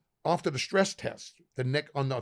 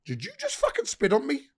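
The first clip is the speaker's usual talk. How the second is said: raised, high-pitched indignant challenge; retold angry confrontation; 9 semitones higher than usual. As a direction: replay a threat, pitched up in outrage.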